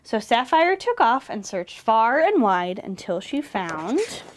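usual speaking voice

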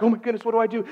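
high-pitched retold panic; husband panicking, voice jumping up; freaking out. Husband finding his wife collapsed, freaking out. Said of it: "freaking out in that moment".

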